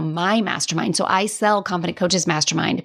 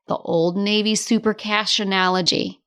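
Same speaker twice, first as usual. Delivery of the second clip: ordinary talk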